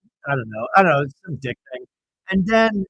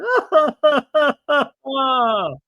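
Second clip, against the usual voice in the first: high burst of laughter; 10 semitones higher, 5 dB louder than usual. Real, not acted. Host laughs after the trailer.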